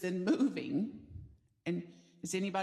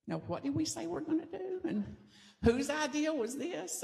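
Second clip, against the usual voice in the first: laughing mock doubt; 7 semitones higher than usual. Team doubting their own plan.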